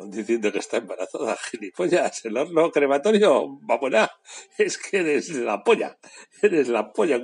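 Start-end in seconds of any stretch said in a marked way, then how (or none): 4.6-7.2 laughing through the words. Real, not acted. Host mocking a celebrity gaffe, laughing. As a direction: crack up while mocking her.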